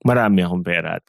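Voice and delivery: normal talking voice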